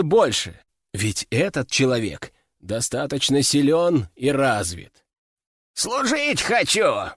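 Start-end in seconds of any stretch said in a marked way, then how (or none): 5.7-7.1 put-on slow, high creaking old voice; creaky old man. A narrator's reading. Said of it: "проскрипел старик".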